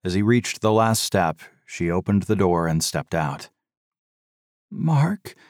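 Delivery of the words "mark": high, trembling question; a frightened wife asks her husband, shakily; shaky question. A narrator's reading. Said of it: "she asked shakily".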